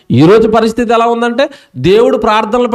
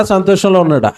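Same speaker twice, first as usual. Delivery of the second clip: usual speaking voice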